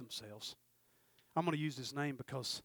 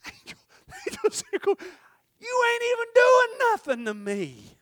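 mocking taunt; 14 dB louder than usual. Jacob taunting the angel, loud and high. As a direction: scoff at the angel's weakness.

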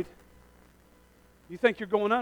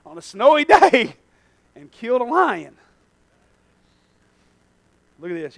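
usual speaking voice

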